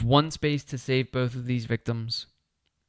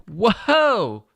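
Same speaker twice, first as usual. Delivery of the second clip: surprised whoa; 8 semitones higher, 7 dB louder than usual. Real, not acted. Surprised by a good card draw.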